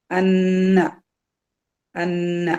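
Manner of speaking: അന്ന with a held nasal nn; two-count ghunnah. Shown as a demonstration. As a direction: hum on the nn of അന്ന. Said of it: "രണ്ട് count മണിക്കുക".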